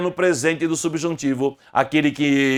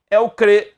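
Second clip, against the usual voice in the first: cre said louder and higher; stressed syllable; 5 semitones higher, 5 dB louder than usual. Shown as a demonstration. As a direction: punch cre. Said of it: "sílaba tônica de creem".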